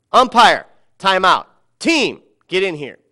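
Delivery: loud coach calls across a field; calling out. Retold or imitated. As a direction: shout orders to umpire and team.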